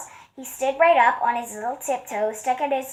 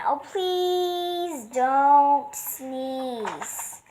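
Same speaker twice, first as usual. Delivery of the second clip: very slow, drawn-out plea; bees' slow begging refrain; pleading; 3 semitones higher, much slower than usual. Acted. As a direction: stretch each word, begging. Bees beg the elephant.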